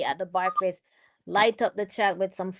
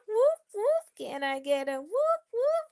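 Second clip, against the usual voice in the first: much higher, hyping the crowd; whoop call; 13 semitones higher, 4 dB quieter than usual. Real, not acted. Host hyping up viewers in the chat, high excited whoop.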